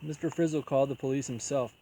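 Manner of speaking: plain reading voice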